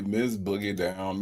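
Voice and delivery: low, soft announcer name drop; hushed announcement. Real, not acted. First take of guest's announced name.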